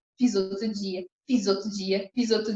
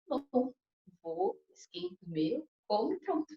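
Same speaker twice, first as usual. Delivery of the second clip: higher, softer easygoing reply; calm acceptance; 8 semitones higher, 4 dB quieter than usual. Retold or imitated. Wife accepting calmly after the husband's no, soft and high. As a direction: let it go sweetly.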